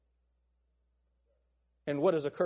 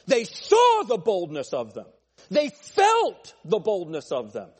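bold preaching; 8 dB louder, slower than usual. Real, not acted. Preacher building to bold point.

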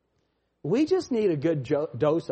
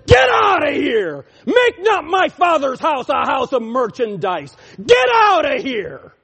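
higher, louder shout; Jesus driving them out; 7 semitones higher, 9 dB louder than usual. Retold or imitated. Preacher voicing Jesus shouting merchants out. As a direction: bellow it like clearing the temple. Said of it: "the Jesus that made the whip and said".

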